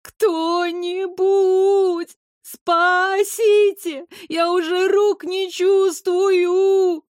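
high, drawn-out wail for help; terrified wailing. A narrator's reading. Girl clinging to a tree calls for rescue, terrified wail. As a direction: wail slowly in terror, stretching the words. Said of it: "тихонько подвывала от ужаса".

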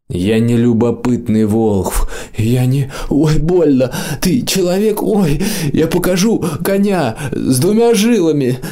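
high whimpering pain voice. A narrator's reading. Imp yelps under blows.

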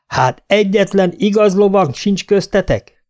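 high despairing cry; kétségbeesett kiáltás; desperate shout. A narrator's reading. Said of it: "üvöltötte kétségbeesésébe".

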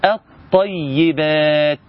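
slow "الطيبات", lam silent, heavy doubled ط; assimilated lam. Shown as a demonstration. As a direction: draw out "الطيبات" as at-tayyibaat, long final vowel. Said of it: "كيف تنطق؟ تنطق".

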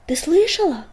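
high, loud question shouted out; calling out. A narrator's reading. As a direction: call out over the wind. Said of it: "крикнул он".

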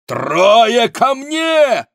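very high, loud, drawn-out summons; shout. A narrator's reading. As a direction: yell for reinforcements, stretching the words. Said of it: "крикнул Монсоро". (протяжный громкий крик)

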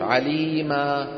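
slow chanted عليما with a long final a; teacher chanting the pausal form; stop with alif. Shown as a demonstration. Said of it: "بنقف عليه بالألف".

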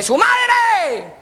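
high, drawn-out yell; shouted insult. Acted. Spectator yells at bobsledders racing past, shouted insult. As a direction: heckle from far off at the top of your voice. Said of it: "yo les grité".

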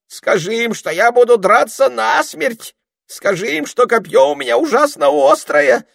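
high, loud fake bravery; scared bluster. A narrator's reading. Ermine boasting to scare the children, high and shaky. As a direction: bluff while terrified.

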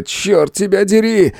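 high, exasperated outburst; cursing. A narrator's reading. Hero swears at wounded friend, exasperated. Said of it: "выругался я".

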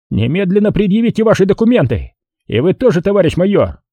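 high-pitched urgent order; official demand. A narrator's reading. Patrol major demanding officers' papers, urgent command.

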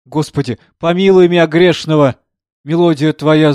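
loud, high ecstatic plea; fervent outcry. Acted. Composer pressing the music to his chest, fervent loud cry. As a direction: cry out to God in rapture. Frenzied praying over sheet music.

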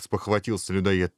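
plain reading voice

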